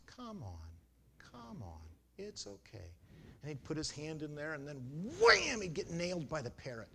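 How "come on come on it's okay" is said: soft and gentle, coaxing a pet. Retold or imitated. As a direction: quietly soothe a biting bird. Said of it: "spoke to it in nice, soft tones".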